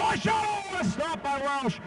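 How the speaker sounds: sudden high shout, then a slowed marvelling oh; excited yelp. Real, not acted. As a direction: leap on the shot, then savour the stop. Sudden shot, commentator yelps.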